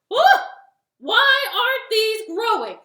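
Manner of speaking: high, very loud frustrated tirade; mad yelling. Acted. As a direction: yell at the seeds with drawn-out cries. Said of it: "he yelled and yelled and yelled".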